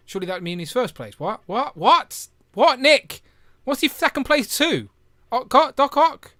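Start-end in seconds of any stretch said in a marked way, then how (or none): 1.2-6.3 high, loud incredulous questioning; real bafflement. Real, not acted. Host baffled by a viewer's contradictory ranking, loudly incredulous. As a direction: escalate into loud, high-pitched disbelief.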